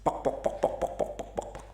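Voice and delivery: whispered pok pok clucks; hen clucking. Pretend. Mother hens cluck back at the owl in whispers. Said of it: "induk ayam-ayam itu".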